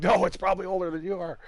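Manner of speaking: high, hurried mock outcry; retold protest. Dad retelling his answer to his son, fast high mock protest. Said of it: "I'm like".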